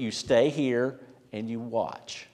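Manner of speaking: slow, deliberate instruction in a lightly voiced Jesus; slow quoted command. Preacher quoting Jesus telling disciples, slow and deliberate. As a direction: lay out each word slowly, a gentle order. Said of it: "He says".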